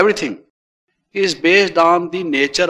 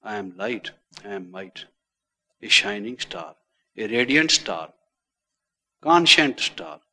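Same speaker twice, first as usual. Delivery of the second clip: meditative affirmation; 5 semitones lower, 5 dB quieter than usual. Acted. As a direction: murmur each line like a meditation mantra. Recites meditation affirmations softly.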